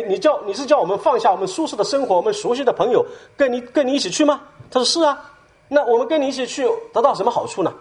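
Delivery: incredulous scoffing. Retold or imitated.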